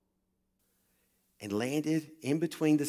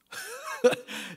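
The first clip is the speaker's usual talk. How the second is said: high delighted laugh. Real, not acted. The preacher telling the congregation, a high delighted laugh. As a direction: squeal of a laugh, pitched way up.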